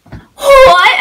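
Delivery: a very high, loud squealed what; excited squeal. Retold or imitated. Amira and mom meeting the fan, squealing what in delight. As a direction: shriek it in delighted surprise. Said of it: "they came over and they were like".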